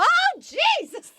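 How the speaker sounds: very high, drawn-out squeal; mock exclamation. Guest joking about her kind of Jesus, squealed exclamation. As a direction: cry it out slowly, comically high.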